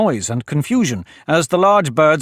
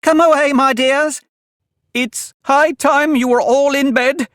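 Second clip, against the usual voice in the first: very high, fluttery bird voice; trembling call; 14 semitones higher, 4 dB louder, slower than usual. A narrator's reading. Canary calls its children away, trembling high voice. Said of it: "called out in a trembling voice".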